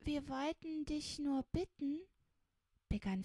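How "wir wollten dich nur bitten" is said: higher-pitched, halting request; falter, then ask meekly; startled small-voiced plea; timid hesitation. A narrator's reading. Startled child begins timid request.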